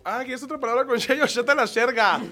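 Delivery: high, playful lisp turning every s into sh, sheta la sherga; sh-lisp bit. Shown as a demonstration. Comic telling a friend her routine bit, with a mock sh lisp. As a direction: ham up the sh sound like a comedy routine. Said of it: "Digo así como".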